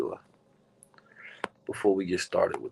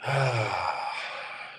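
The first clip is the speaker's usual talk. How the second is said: long, soft sighing exhale; soft sigh trailing away; real sigh; 6 dB quieter than usual. Real, not acted. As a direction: breathe out slowly and audibly. Pausing before starting the meditation.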